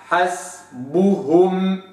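slow حسبهم with full ضمة on ب; slow recitation; full vowel. Shown as a demonstration. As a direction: complete the u in حسبهم. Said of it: "حسبو".

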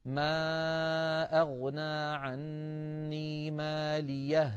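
very slow melodic chant, the two ha sounds merged; recitation with merged ha. Shown as a demonstration. Teacher demonstrating the merging option, chanted. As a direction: chant ماليه هلك with the ha merged. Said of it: "فيقول".

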